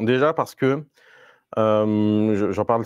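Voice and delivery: ordinary delivery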